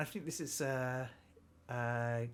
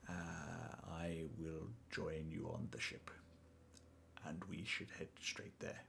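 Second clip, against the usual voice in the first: low, soft, deliberate character voice; measured in-game character; 5 semitones lower, 12 dB quieter than usual. Acted. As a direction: speak quietly and formally in character.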